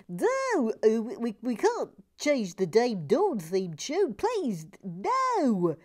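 loud mock-anguished protest with swooping pitch; hammed-up pleading. Viewer begs to keep the theme tune, hammed-up pleading. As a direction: plead theatrically, as if horrified.

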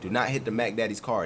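usual speaking voice

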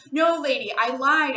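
loud, high, panicked shout; frightened shout. A narrator's reading. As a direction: yell it out in terror. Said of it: "I shouted loudly".

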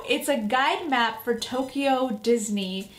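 ordinary talk

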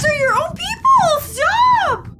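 yelling at the screen to stop; real shout. Real, not acted. Viewer yelling at soldiers shooting their own, real shout.

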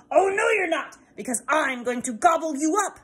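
troll threatening to gobble the goat, loud; troll threat. Acted.

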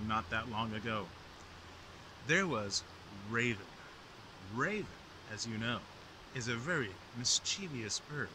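storyteller voice; story narration. A narrator's reading. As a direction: tell it like an old legend. Raven story told to viewers.

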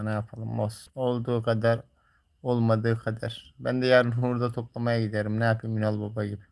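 ordinary delivery

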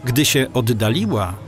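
ordinary delivery

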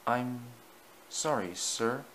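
soft, halting, subdued; meek apology. A narrator's reading. Easton to the Fat Controller after being caught, meek. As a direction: shrink into a small, hesitant apology.

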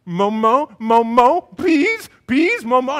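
put-on loud, high baby-talk begging; demanding toddler. Toddler begging dad for hash browns, loud and demanding. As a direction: imitate a greedy baby clamouring for food. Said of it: "She's like".

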